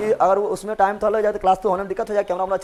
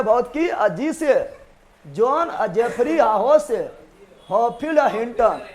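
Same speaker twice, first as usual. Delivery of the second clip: slow recitation; 3 dB quieter, slower than usual. Shown as a demonstration. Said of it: "teacher recites mnemonic slowly".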